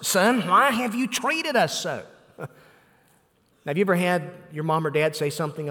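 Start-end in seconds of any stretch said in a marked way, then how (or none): 0.1-2.0 a hint of an exasperated Mary, pitched up; exasperated mother. Preacher voicing Mary scolding the boy Jesus, mildly exasperated. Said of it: "notice how Mary responds".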